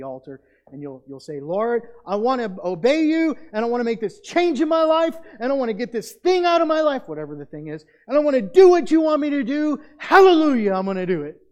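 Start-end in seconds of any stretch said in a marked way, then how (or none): 1.4-7.1 loud, high-pitched cry out to God; fervent altar prayer. Acted. 8.1-11.4 shouted high and very loud, easing at the end; exultant altar vow. Acted. Acted fervent altar prayer.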